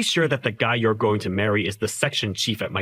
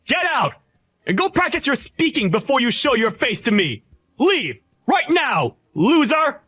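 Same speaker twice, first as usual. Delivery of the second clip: furious shout; 11 semitones higher than usual. Acted. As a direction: scream him out of your wedding. Groom screaming him out of wedding.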